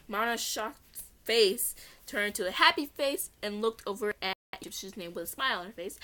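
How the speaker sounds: usual speaking voice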